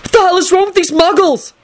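high, exasperated shout; incredulous angry yell without much extra volume. A narrator's reading. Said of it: "Neville shouted".

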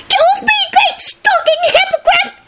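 extremely high squeaky shout; squealing elf. A narrator's reading. Elf crushed by a man's landing, furious squeal. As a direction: squeal it like an outraged tiny elf. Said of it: "squealed one of the elves".

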